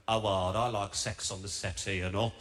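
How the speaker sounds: low-pitched local bloke voice; low, mock pub-regular voice; pub chancer. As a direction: imitate a bloke chatting someone up. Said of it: "This guy came up to her and he said".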